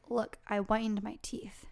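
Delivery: whispered creepy boast. Pretend. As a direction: whisper it like a grinning monster. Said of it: "He's like".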